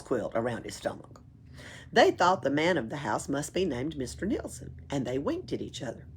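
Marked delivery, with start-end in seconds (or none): none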